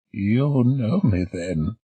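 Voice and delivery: put-on slow, high pleased old voice; pleased old lady. Old lady pleased the doctor knows her, slow and high. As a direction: sound delighted to be known. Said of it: "genuinely pleased".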